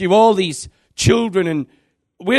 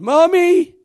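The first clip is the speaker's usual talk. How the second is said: imitating a girl crying out, much higher and louder; a hammed-up child crying for her mother; child's cry; 12 semitones higher, 5 dB louder than usual. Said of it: "that girl's crying".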